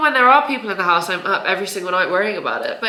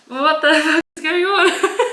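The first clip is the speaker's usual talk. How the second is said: slow amused disbelief, laughing through it; 7 semitones higher, 4 dB louder, slower than usual. Real, not acted. Surprised at her own reflectiveness, laughing slowly. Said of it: "Look at me being reflective already".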